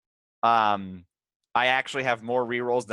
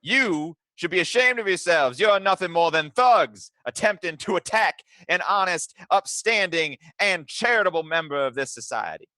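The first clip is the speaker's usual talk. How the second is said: higher-pitched righteous outrage; indignant tirade; 6 semitones higher than usual. Acted. Player's character shouting down the attacking thugs, indignant. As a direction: scold them as an outraged upstanding citizen.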